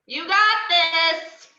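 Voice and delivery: loud, high slow cheer. Acted. Drunk Harley cheering Canary on in the fight. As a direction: yell it from the ground like a fan.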